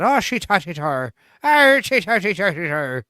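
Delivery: lightly put-on Irish accent with a rising burst; mock Irish accent. Streamer showing the Irish accent he thinks he does better. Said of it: "I kinda like that".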